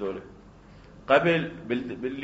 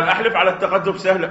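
eager oath; 5 semitones higher, 7 dB louder than usual. Acted. Buyer eager to swear.

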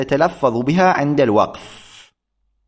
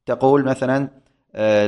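ordinary delivery

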